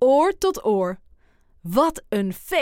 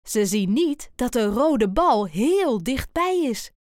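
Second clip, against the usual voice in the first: plain reading voice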